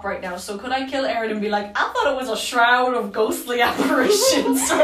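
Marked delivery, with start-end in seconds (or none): 1.8-4.8 put-on comic Southern accent, breaking into laughter. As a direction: plead it in a mock Southern accent. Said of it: "everyone in London ... is from the South".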